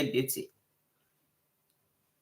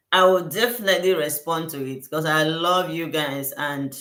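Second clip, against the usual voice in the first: ordinary talk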